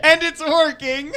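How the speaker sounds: loud laughing remark. Real, not acted. Laughing aside.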